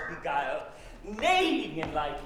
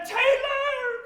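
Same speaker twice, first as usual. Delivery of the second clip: shrieked cry; 7 dB louder than usual. Acted. Puck mimics old woman's cry.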